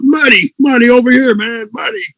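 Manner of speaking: high shouted call across a room. Retold or imitated. Record guy calling across room.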